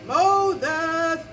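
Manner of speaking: booming, drawn-out call; loud, stretched summons; God calling. Retold or imitated. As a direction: call out the name like God from the bush. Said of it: "And he said".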